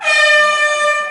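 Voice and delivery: high, loud startled "Oh"; mock surprised yelp, high and loud. Mock exclamation in the fight.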